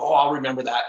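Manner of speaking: usual speaking voice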